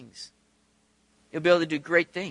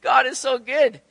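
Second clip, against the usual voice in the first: higher, louder delighted awe; joyful amazement; 5 semitones higher, 5 dB louder, slower than usual. Retold or imitated. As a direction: marvel happily, with no mockery. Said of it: "It's not mocking".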